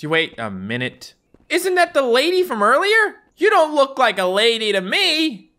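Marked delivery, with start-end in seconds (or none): 1.5-5.5 mock surprise. Mocking a suspicious entrant.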